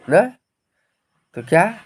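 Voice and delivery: ordinary talk, nothing marked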